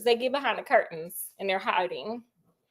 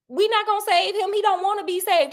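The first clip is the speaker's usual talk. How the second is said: ordinary delivery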